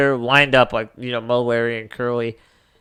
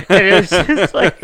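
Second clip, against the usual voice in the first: laughing; 6 semitones higher, 9 dB louder than usual. Real, not acted. Laughing while retelling joke.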